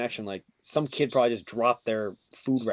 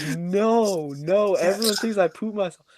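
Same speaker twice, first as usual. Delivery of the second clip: high, drawn-out protest; dismayed wail; 7 semitones higher than usual.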